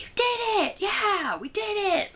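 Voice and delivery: very high, loud cheer of victory; cheering uncle. Retold or imitated. Uncle Bob cheering his niece up the hill, high excited shout. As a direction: shout it like a proud uncle celebrating. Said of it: "and Uncle Bob's like".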